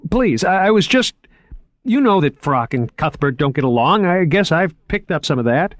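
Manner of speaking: put-on anxious, placating young man; flustered Moriarty. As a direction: scramble to smooth it over.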